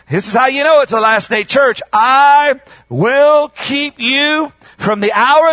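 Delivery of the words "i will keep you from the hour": slow, raised proclamation; solemn emphatic promise. Acted. Preacher voicing God's promise to the church, slow and emphatic. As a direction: proclaim it with long, weighty words.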